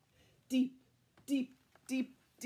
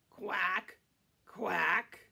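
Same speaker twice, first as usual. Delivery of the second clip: loud, low quacks; duck quack; 4 semitones lower, 6 dB louder than usual. Shown as a demonstration. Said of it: "A duck".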